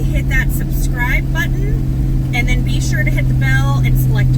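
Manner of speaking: loud, high, fast channel plug; announcer voice. Acted. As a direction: hype it up like an ad read. Subscribe plug.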